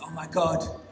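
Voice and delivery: real excitement on finding the cat; excited. Real, not acted. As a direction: tumble out the questions in disbelief. Spotting the lost cat.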